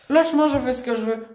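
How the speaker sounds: high-pitched defiant outburst; angry protest. Real, not acted. Young woman resisting the educator's hold, angry protest. Real scuffle, resisting him.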